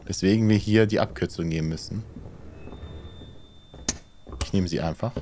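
normal talking voice

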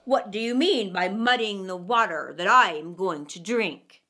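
a hungry wolf picking a fight; fierce wolf. A narrator's reading. Wolf to lamb by the stream, fierce and low. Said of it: "said he fiercely".

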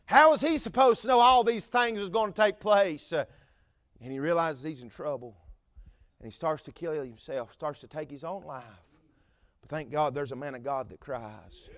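ordinary delivery

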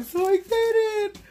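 high, loud and drawn out with delight; excited squeal. Real, not acted. Unboxing a gift from her friend Mary, high drawn-out squeal.

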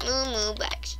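soft, half-whispered echo; whispered repeat. Pretend. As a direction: repeat it softly, almost whispering.

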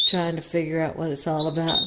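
ordinary talk

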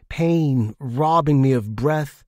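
ordinary delivery

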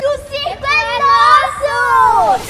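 loud, slow triumphant shout; excited shout. Real, not acted. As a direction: yell it out in triumph. Kids sneak into empty circus.

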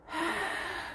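soft long breath; soft, drawn-out sigh; deep breath. Acted. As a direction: take another calming breath. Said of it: "Take a deep breath".

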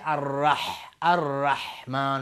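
slow «الرحمن» with mid-throat ḥ; correct ha. Shown as a demonstration. Teacher shows the proper haa jimi, correct ha. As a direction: hold a strong raspy ḥ in «الرحمن». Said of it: "اگر های جیمی رو درست تلفظ نکنه".

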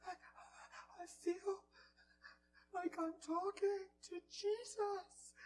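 slow, wondering, lightly voiced; awestruck lady. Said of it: "ladies trembling".

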